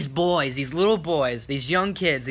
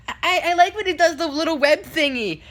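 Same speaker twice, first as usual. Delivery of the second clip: put-on high, eager child voice, stammering at the start; little kid; 12 semitones higher, 4 dB louder than usual. Little fan answering Spider-Man, imitated kid voice. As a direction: imitate an excited little boy. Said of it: "a little kid, one of the kids".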